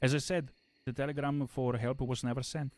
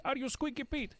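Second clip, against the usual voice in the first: very high squeaky question; 13 semitones higher than usual. Retold or imitated. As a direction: squeak it out like a cartoon. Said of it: "called Squeaky Pete".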